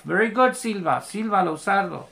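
ordinary delivery

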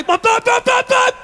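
high, rapid-fire bumping car sound; imitated car noise. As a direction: mimic a car bouncing down the driveway. Imitates car tearing down driveway.

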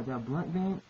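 normal talking voice

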